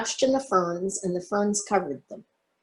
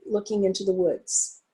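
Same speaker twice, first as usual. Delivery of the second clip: ordinary delivery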